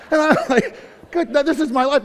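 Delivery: high, laughing through the words. Real, not acted. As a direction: chuckle as you tell the punchline. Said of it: "laughing at his own story".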